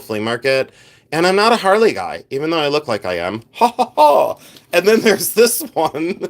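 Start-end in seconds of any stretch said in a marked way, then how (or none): 3.5-4.4 high hammed-up "ha ha ha"; fake laugh. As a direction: laugh at your own joke, faked. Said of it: "while I fake laugh".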